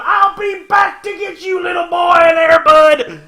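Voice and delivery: very high, loud hammed-up threat; villain shout. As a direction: yell the revenge vow like a cartoon villain. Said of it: "and he's going".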